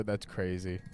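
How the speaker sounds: normal talking voice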